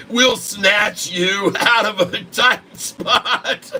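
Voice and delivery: bursting into laughter mid-sentence; laughing. Real, not acted. Reading ad, cracking up.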